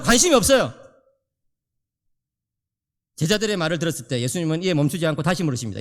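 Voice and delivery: normal talking voice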